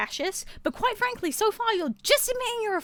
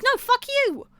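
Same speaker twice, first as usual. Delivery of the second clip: angry retort; 6 semitones higher, 3 dB louder, slower than usual. Retold or imitated. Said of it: "And whereas I'm like".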